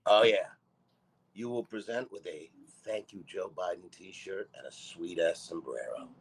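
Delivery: ordinary talk, nothing marked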